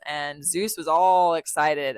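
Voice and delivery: ordinary delivery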